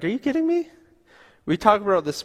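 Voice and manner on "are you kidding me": much higher, mock-incredulous squeal at herself. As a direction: scold yourself in disbelief in the mirror. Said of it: "And it's like".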